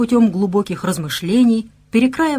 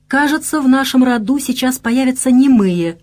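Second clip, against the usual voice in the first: higher-pitched, mildly cross jab; angry hiss; 5 semitones higher, 4 dB louder than usual. A narrator's reading. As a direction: narrator voicing a cross young wife. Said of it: "прошипела она сердито".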